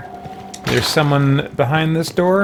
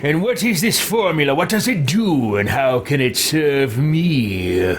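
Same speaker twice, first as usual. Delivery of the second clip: haughty game hero. Acted. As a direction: play a self-important hero, savouring each word. Player voices his game character.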